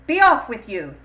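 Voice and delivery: loud, sharp dismissal; shout. A narrator's reading. Kechi drives Chinye into the night, shouting. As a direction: throw the girl out of the house. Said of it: "Kechi shouted".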